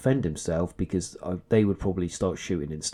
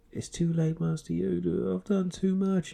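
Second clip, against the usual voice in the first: put-on high, soft pleading young man; pleading Anakin; 10 semitones higher, 3 dB quieter than usual. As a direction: beg for forgiveness, voice up high.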